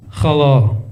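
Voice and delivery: a wary Russian stranger answering; mock Russian greeting; Russian accent.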